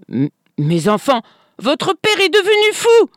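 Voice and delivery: plainte haute et affolée; gémit, désemparée, aux enfants; gémissement. A narrator's reading. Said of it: "gémit-elle". (gémissement aigu et affolé)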